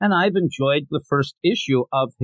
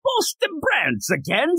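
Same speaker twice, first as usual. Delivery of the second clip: very high, slow fake British; hammed-up British chimney sweep, stretched out; bad British accent; 13 semitones higher, slower than usual. Shown as a demonstration. Host reads the solicit in a really bad British accent. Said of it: "like, a really bad British accent".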